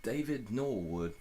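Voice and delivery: practising a British accent. Shown as a demonstration. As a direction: drawl the name the British way. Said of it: "You have to practice the British accent".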